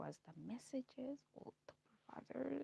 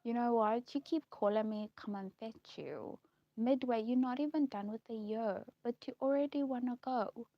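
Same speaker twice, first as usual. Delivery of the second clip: louder scolding dad, retold; negative father; 4 semitones higher, 7 dB louder than usual. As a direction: imitate a fed-up father telling off his daughter. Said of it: "he was just so negative now".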